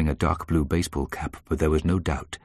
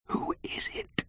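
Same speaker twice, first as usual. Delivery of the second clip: hushed, breath-only question; whispered; 10 dB quieter, slower than usual. A narrator's reading. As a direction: whisper it so nobody outside hears. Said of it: "whispered my mother".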